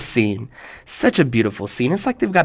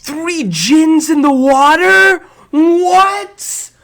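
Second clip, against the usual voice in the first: high, slow gasped disbelief; mock shock; 13 semitones higher, 4 dB louder, much slower than usual. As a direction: play amazed for the kids.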